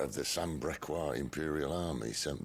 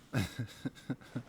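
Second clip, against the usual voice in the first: short chuckle; 9 semitones higher than usual. Acted. Amused after cave remark.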